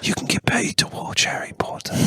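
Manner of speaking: breathy ASMR whisper. Pretend. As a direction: whisper it close. Parody whispered ad.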